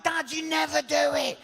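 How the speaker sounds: son's outraged telling-off; exasperated son. Retold or imitated. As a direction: tell dad off in disbelief. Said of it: "He's like".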